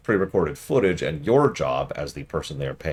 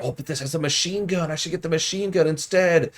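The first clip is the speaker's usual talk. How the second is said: higher, mock-thrilled streamer voice; mock excited streamer; 11 semitones higher than usual. Fake streamer gets excited over the machine gun, higher. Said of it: "they're shoutcasting it".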